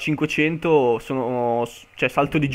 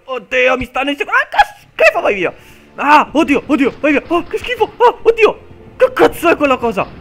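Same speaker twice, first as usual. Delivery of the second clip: panicked screaming; 11 semitones higher, 8 dB louder than usual. Real, not acted. Monster attacking him in game.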